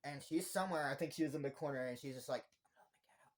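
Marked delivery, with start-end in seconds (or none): 2.7-3.3 barely audible creepy whisper. Retold or imitated. Imitating Nikki in the dark corner, whispering. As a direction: whisper it from the shadows.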